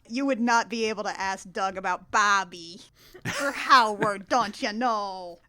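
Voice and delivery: put-on Minnesota accent. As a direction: lean into a folksy Minnesota accent. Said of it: "my Minnesota accent abilities".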